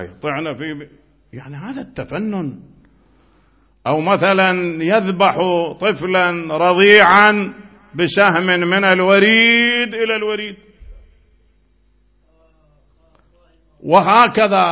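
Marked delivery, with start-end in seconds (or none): 3.9-14.6 preach. Acted. Impassioned account of a killed infant.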